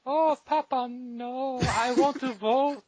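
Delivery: high, whining falsetto daughter; British girl. Acted. Daughter begging papa to let her vote, high pleading voice. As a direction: plead in a stretched-out girlish falsetto. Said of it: "That's not my British girl accent".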